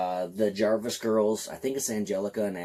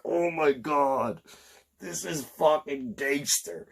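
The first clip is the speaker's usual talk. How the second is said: slow, drawn-out gasp of awe at a movie moment; awestruck; 4 semitones higher, slower than usual. Retold or imitated. Reliving the Steve Dash scene, slow stunned awe. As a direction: let the awe stretch every word out. His own awed reaction to the scene.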